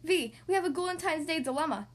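usual speaking voice

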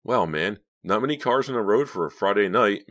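ordinary delivery

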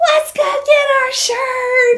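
high, slow, loud rallying cry; excited cheer. Retold or imitated. Hikers off the mountain, high excited cheer. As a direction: call it out like a victory chant. Group cheering after descent.

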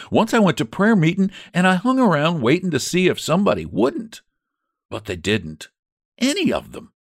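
maid's voice. Acted. Narrator voices Elpha the maid.

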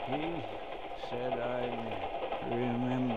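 slow, soft and fading, barely holding on; dying words. Acted. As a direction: push each word out with your last breath. Dying man on the floor.

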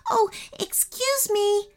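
put-on a young girl, high and in a rush; hurried girl. A narrator's reading. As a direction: blurt it out quickly, a little flustered. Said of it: "said Ananya hurriedly".

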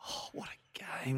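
slow, awed whisper. Real, not acted. On air, recalling the AFLW game in a whisper. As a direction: breathe it like a treasured memory. Savouring the match.

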